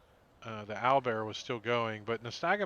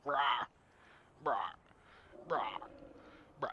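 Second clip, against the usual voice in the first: owlbear squawks; much faster than usual. Acted. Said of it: "he says, damn sound effect".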